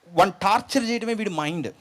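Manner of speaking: ordinary talk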